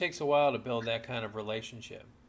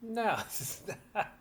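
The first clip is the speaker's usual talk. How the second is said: laughing no; 9 semitones higher, slower than usual. Real, not acted. Friend laughs while disagreeing.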